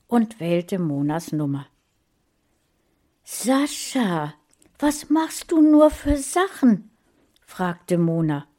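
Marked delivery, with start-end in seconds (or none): 3.2-6.9 concerned woman. Acted. Caring helper speaks on phone.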